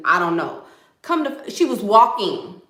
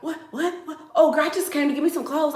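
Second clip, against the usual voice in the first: fast, higher rambling; fast and jittery; 7 semitones higher, faster than usual. Retold or imitated. Imitating the jittery missing girl, fast and high. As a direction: rattle it off like she's high. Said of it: "talking all fast and stuff".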